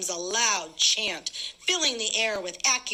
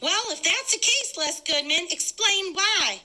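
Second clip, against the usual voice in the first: woman accusing; 9 semitones higher than usual. Acted. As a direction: challenge him in a high woman's voice. A woman confronts Goodman.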